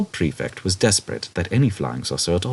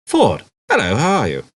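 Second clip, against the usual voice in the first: a touch surprised, louder and higher; startled greeting; 5 semitones higher, 5 dB louder than usual. A narrator's reading. Arthur startled to see Ford standing above him, surprised. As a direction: caught off guard, greet him.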